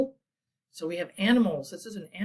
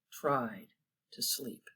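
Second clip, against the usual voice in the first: fading whisper; 8 semitones lower, 10 dB quieter, slower than usual. A narrator's reading. Sleepy owl line fading.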